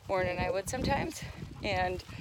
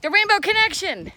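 excited exclamation; 9 semitones higher, 9 dB louder than usual. Retold or imitated. Excitedly remembering the award.

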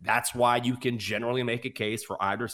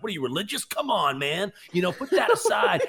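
pushy tout; 7 semitones higher than usual. Retold or imitated. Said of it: "the guys like".

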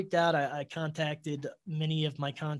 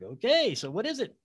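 high, louder, eager okay; eager question; 7 semitones higher, 5 dB louder than usual. Retold or imitated. Man asking the guides his job, high and eager. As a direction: jump at the answer.

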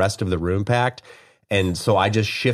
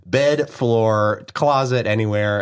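ordinary delivery

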